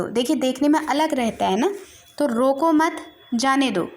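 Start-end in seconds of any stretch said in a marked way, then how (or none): 2.3-3.9 "रोको मत" said as one phrase, then a clear pause before "जाने दो"; pause after मत. Shown as a demonstration. Teacher shows the comma after "मत" with a pause. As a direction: break the line after "मत", like a comma. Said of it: "ये पहले में "मत" के बाद कॉमा लगा है".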